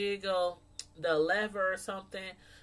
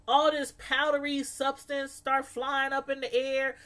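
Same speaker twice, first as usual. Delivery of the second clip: usual speaking voice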